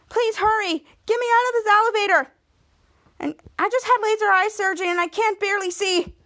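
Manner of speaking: high-pitched pleading whine; mock whining. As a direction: imitate someone whining to be rescued. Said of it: "Okay, stop whining".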